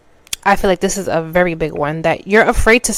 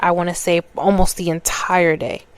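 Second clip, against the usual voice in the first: usual speaking voice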